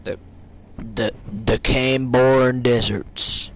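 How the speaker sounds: slow, drawn-out American movie-trailer voice; American Western accent. Pretend. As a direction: sell it like a Western epic. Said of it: "it has that Western feel".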